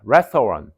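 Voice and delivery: restaurant with three parts, "res-to-ran"; English pronunciation. Shown as a demonstration. Teacher modelling the reading, low "res-to-ran". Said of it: "이렇게 읽어야 돼".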